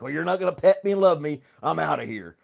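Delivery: loud mock huff, falling off at the end; lightly voiced pet. Streamer voicing Robert walking off unpetted, mock huffy. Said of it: "He's like".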